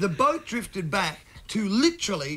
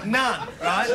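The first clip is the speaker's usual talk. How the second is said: ordinary delivery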